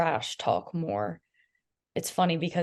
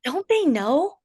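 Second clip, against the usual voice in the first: high, loud disbelief; teasing imitation of a partner's on-court remarks; mock incredulous; 9 semitones higher, 4 dB louder than usual. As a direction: sound baffled at the opponents. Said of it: "Or, like".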